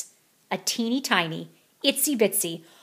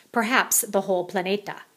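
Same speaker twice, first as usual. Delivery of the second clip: plain reading voice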